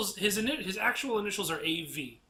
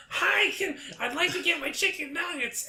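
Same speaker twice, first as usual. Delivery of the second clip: chirpy high customer voice; 12 semitones higher than usual. Retold or imitated. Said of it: "you were like".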